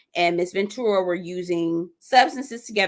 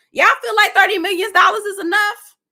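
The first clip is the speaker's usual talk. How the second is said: incredulous question; 12 semitones higher, 7 dB louder than usual. Real, not acted. Asking chat about the settlement.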